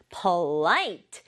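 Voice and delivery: "Polite" with a long, prominent second syllable; stress on LITE. Shown as a demonstration. Teacher models the word for pupils, "Polite" stressed on LITE. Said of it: "강조를 light 부분에 줘야 돼요".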